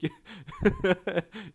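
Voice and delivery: sudden high burst of laughter; mocking laugh. Real, not acted. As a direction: laugh at the absurdity. Mocking Konami's excuses. (alaycı kahkaha)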